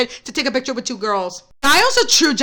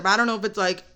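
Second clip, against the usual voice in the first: usual speaking voice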